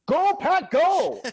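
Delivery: loud, high-pitched fan chant; excited shout. Retold or imitated. Japanese fan answering a stranger, excited shout. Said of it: "the guy turned around and said".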